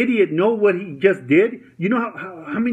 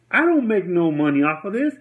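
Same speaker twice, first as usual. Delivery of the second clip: normal talking voice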